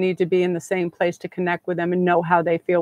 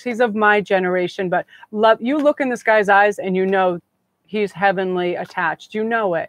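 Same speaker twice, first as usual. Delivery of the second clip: ordinary talk, nothing marked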